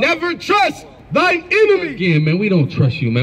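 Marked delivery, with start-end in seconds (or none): 0.0-1.9 high, slow scripture reading; scripture declamation. Real, not acted. Reader proclaiming scripture at an opponent in debate, declamatory. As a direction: proclaim each word slowly, pitched up high.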